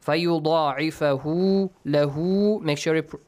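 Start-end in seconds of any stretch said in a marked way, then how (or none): 0.1-2.1 لَهُ chanted with the final u held long; prolonged vowel. Shown as a demonstration. Teacher showing prolonging, long final vowel on لَهُ. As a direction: draw out the end of فَيُضَاعِفَهُ and لَهُ. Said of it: "prolong it".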